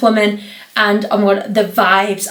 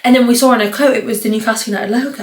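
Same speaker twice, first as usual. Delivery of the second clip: ordinary talk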